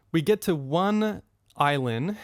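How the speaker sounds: ordinary talk, nothing marked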